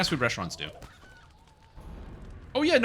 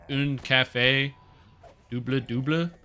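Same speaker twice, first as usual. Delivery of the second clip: put-on slow, careful Quebec French accent on "Un café"; accent québécois, lent et appliqué; slower than usual. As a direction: order the coffee slowly in a Quebec French accent. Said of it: "And it's an accent?".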